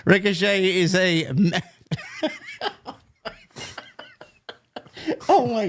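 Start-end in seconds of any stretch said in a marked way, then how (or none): 1.9-2.9 squeaky falsetto laugh; high-pitched giggle. Real, not acted. Laughing at crude joke.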